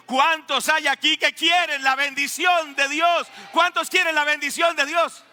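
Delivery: high, ringing pulpit call; rallying preacher. Real, not acted. Preacher asking who wants God's blessing, high rallying shout. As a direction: call out to stir up the crowd.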